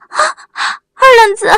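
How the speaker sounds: gasp, then a high, loud scream of his name; shrieked cry. A narrator's reading. Wang Mei screams on seeing Erlengzi, shrieking. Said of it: "王梅尖叫一声".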